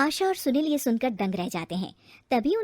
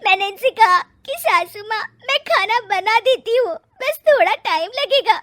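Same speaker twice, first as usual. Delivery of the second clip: higher, loud hammed-up sobbing complaint; a bride badly faking a sob to her husband; fake crying; 10 semitones higher, 8 dB louder than usual. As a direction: fake tears to win the husband. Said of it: "रोने का नाटक करने लगती".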